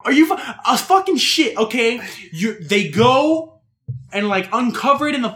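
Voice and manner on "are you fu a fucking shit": outraged disbelief. Real, not acted. Outraged at eating turtle eggs.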